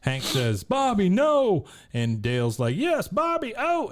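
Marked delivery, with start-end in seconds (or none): 0.6-1.6 high, loud, drawn-out plea to stop; mock desperate shout. Father tries stopping the shot. 2.8-3.9 very high, urgent egging-on; mock frantic cheering. Dale eggs boy on.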